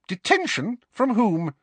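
high, sharp questioning. A narrator's reading. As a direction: demand answers like a strict teacher. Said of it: "said Professor McGonagall sharply".

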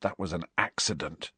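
low, soft, miserable murmur; miserable whisper. A narrator's reading. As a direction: confess it wretchedly under your breath. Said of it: "he whispered miserably".

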